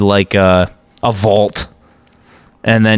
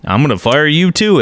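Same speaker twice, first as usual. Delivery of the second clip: usual speaking voice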